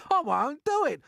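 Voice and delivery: high, loud frantic stammer; panicked cry. A narrator's reading. Earthworm refusing to be bait, panicking. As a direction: shriek in terror.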